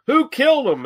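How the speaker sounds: high, frantic "who killed him"; a hammed-up panicked gasp of a question; mock frantic cry. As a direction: cry out like she just found the body. Heroine acted in retold scene.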